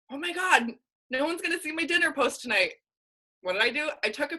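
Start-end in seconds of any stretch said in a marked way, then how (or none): 0.1-2.7 mock dismay. Retelling her own dismay over dinner.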